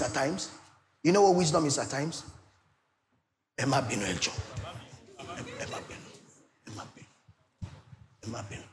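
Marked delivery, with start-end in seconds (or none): 3.6-8.6 soft, low Yoruba plea for pardon; hushed apology. Acted. Preacher shows congregation how wisdom apologizes, hushed and pleading. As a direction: murmur it, meekly pleading.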